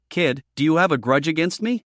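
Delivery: usual speaking voice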